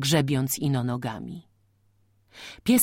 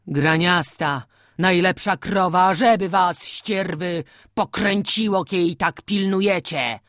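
angry farmer; 3 semitones higher, 5 dB louder than usual. A narrator's reading. Farmer curses at losing cow.

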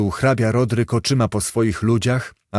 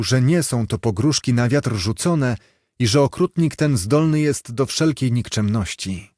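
ordinary delivery, nothing marked

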